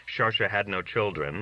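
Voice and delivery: ordinary delivery, nothing marked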